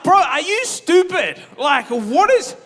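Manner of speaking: high, loud and slow exasperated outburst; mock angry. Telling off his friend for inviting Grace: high, loud mock fury. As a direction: blast your friend in disbelief. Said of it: "but I was so mad".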